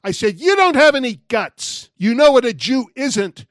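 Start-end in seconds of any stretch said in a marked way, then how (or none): 0.4-1.9 much higher and louder challenging shout; shouting after him. Retold or imitated. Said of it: "I finally shouted after him". 2.0-3.5 loud, higher challenge, punch "know"; shouting after him. Retold or imitated. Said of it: "I finally shouted after him".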